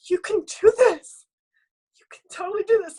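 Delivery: crying while quoting his pep talk; sobbing out the husband's you can do this; tearful encouragement. Retold or imitated. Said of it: "He was like".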